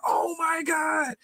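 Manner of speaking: slow, drawn-out high exclamation; amazed realization. Retold or imitated. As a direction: imitate someone astonished, stretching every word high. Retelling Harold's amazed realization.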